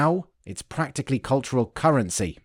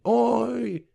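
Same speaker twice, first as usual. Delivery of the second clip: high, drawn-out Cockney call; Cockney accent; 10 semitones higher than usual. Acted. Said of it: "in a Cockney accent".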